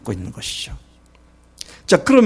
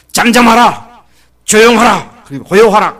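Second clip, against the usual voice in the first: loud, ringing command, high and strong; proclaiming command; 6 semitones higher, 9 dB louder than usual. Acted. Jesus proclaiming calm to the storm, loud and commanding. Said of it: "예수님이 선포하셨잖아요".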